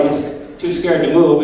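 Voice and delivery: ordinary talk, nothing marked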